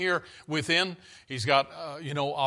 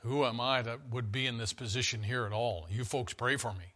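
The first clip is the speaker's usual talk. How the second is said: much softer, lower humble plea; humble and quiet; 5 semitones lower, 9 dB quieter than usual. Retold or imitated. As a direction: hush down like a modest servant. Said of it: "He's saying".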